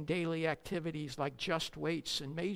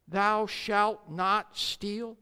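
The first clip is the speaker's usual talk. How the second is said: solemn slow quote; 3 semitones higher, 6 dB louder, much slower than usual. Acted. Quoting commandment solemnly.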